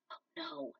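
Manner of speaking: soft voiceless gasp of dismay; shocked whisper. A narrator's reading. Hero hears Cheetah is gone, whispering. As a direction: breathe it out in shock.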